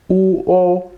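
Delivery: "U o" as two high, held vowels; separate vowels. Shown as a demonstration. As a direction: sound out "U" and "o" apart. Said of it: "originally this was u, o".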